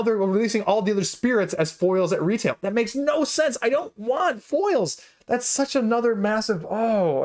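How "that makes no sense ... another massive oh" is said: high, strained exasperation, slowing right down; exasperated. Real, not acted. Reviewer fed up with the crowdfunding campaign, exasperated. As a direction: spell out the frustration word by word.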